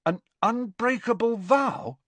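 high-pitched, stunned question; astonished, voice shooting up. A narrator's reading. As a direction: echo it back in shocked disbelief. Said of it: "looking stunned".

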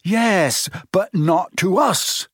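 exasperated. A narrator's reading. Ron objects to Neville standing up to them, exasperated. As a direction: protest in exasperation. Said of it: "said Ron in exasperation".

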